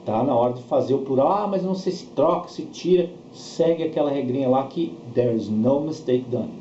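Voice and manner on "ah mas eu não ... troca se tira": normal talking voice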